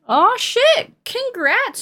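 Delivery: high-pitched excited congratulations; delighted surprise. Real, not acted. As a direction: squeal it, thrilled by the news. Delighted surprise at age-change news.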